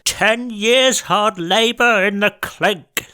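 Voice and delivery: high, slow pompous judge voice; stern judge. Acted. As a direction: pronounce the sentence slowly like a judge. Judge passing sentence.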